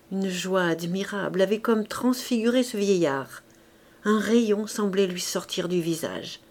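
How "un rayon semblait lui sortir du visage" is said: plain reading voice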